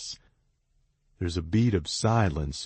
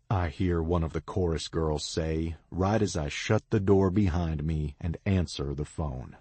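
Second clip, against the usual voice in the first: usual speaking voice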